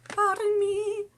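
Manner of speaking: very high, drawn-out apology; silly high voice. Pretend. Speaker excusing a tongue slip, silly high-pitched voice. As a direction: sing it out in a tiny comic voice.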